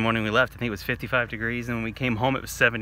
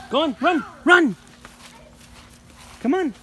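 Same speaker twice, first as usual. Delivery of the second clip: high, loud pet-urging call; excited urging; 14 semitones higher, 6 dB louder than usual. Real, not acted. Owner urging the dog to run to its room, high excited call.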